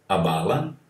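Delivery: normal talking voice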